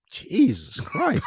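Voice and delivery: high, slowed-down "Jesus Christ"; shocked exclaim. Real, not acted. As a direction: blurt it out in disbelief. Reacting to slanging joke.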